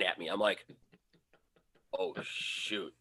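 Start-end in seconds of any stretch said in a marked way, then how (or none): 2.0-2.9 very soft, low uh-oh; hushed, sinking realization; quiet dread. Retold or imitated. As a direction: murmur it low as it dawns on you. Dog stares at him, dread.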